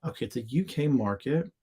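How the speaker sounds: ordinary talk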